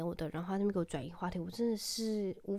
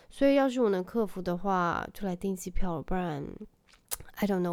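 ordinary talk

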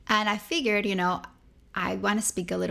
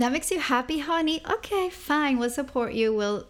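lightly higher, indulgent parent voice; doting parents; 6 semitones higher than usual. Retold or imitated. Parents indulging their daughter's choice, mildly mock sweet. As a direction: play the sweet, giving-in parent.

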